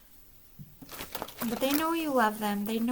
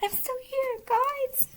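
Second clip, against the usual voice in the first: put-on much higher, small childlike voice; child spirit's reply; 14 semitones higher, slower than usual. Child spirit answering the family, high and childlike. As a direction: chirp it back like a happy little kid. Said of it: "they're like".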